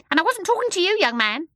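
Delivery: very high, loud, fast bird voice; a scolding, chattering bird; shrill starling. A narrator's reading. The starling scolding the babies from the window.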